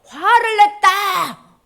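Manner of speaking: slow, thundering acted shout; God's anger. God reminding the people of His anger, slow shout. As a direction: declare it loudly, drawing out each word. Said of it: "하나님이 언제 화냈지?".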